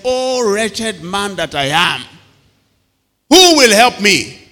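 high, slow cry of despair; anguished lament. Acted. Paul lamenting his wretchedness, slow anguished cry. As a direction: wail it like a broken man. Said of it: "Paul then concludes. He said".

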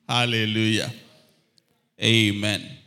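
booming praise shout; loud joyful amen; loud hallelujah. Real, not acted. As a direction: call it out loud to the church. Congregation laughs, preacher exclaims.

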